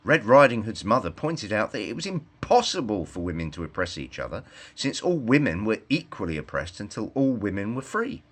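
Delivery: ordinary delivery, nothing marked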